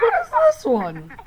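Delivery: voice crack. Real, not acted. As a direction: voice cracks high mid-question. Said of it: "holy voice crack, guys".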